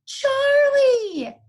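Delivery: high, loud joyful call of a name; excited shout. Acted. Girls calling out to their found cat, loud and high. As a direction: shout out the cat's name in delight. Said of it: "Sarah and Elizabeth cried".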